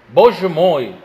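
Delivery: Rudin crying out in alarm; exclamation. A narrator's reading. Rudin reacting to Natasha's news, a loud cry. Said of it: "воскликнул Рудин".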